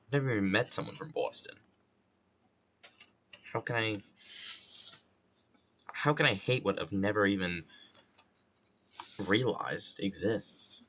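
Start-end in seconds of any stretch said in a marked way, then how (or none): none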